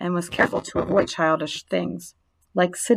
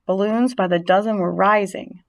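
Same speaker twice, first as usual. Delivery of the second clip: usual speaking voice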